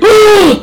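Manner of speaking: loud, high mock gasp; shocked crowd gasp. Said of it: "but they go".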